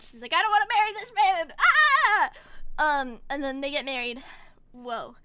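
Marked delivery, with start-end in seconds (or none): 0.3-2.3 loud, high, fast mock tantrum ending in a scream; hammed-up furious bride; mad. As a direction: rattle off the protest, then shriek. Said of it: "she's angry. She's so mad".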